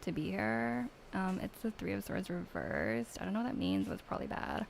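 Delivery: lightly voiced Valley Girl accent with like and uptalk; a hint of a bored Valley Girl accent. Mean Valley Girl accent for a tarot reading. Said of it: "I need to do Valley Girl accent".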